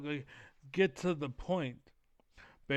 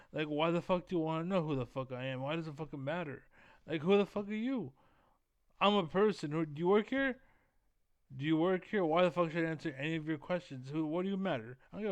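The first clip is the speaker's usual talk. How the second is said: hyped-up confrontational tirade; rough angry rant; 3 semitones higher, 3 dB louder, faster than usual. Acted. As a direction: fire back hard at a nosy stranger. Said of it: "I understand I'm being rough".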